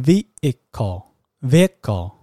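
vehicle said slowly, with a silent h; correct pronunciation. Shown as a demonstration. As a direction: model vehicle slowly, dropping the h. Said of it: "它是要念vehicle". (正确读法vehicle，慢慢念，h不发音)

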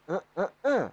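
"eh, eh" jumped high and loud; high-pitched Betawi "eh, eh". Shown as a demonstration. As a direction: show how high the Betawi tune goes. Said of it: "Tinggi gitu".

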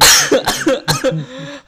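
harsh hacking cough; real cough that won't stop; coughing fit. Real, not acted. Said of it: "batuk nggak sembuh-sembuh".

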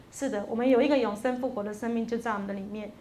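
ordinary talk

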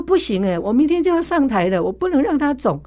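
high, worried pleading, mock alarm; anxious protest. Her own worried reply retold. (略带慌张地提高音调)

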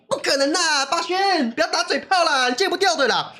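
high teasing voice, friends scoffing; mocking friends. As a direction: mimic friends doubting him. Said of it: "朋友都说". (朋友嘲笑的高声调)

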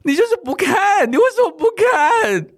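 high, slow, loud indignant yelling; fuming again at the memory; indignant shout. Real, not acted. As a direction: rant at him for not looking. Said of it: "我整个情感又回来了".

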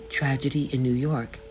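ordinary delivery

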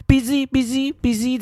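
high, drawn-out dismissive busy; mock busy auntie. Auntie ignoring the complaint, slow high mock brush-off. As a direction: wave them off, slow and high. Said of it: "他们就这样子".

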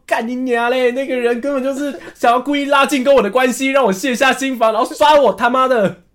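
high-pitched, heated retold outburst; indignant rant. Passenger curses the scamming driver, high indignant rant. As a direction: rant in outrage at being cheated.

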